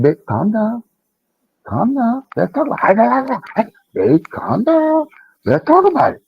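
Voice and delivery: high-pitched, drawn-out imitation of calming a partner; de-escalating plea. Man re-enacting calming his partner, high pleading voice. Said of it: "I'm tired of being the calm one".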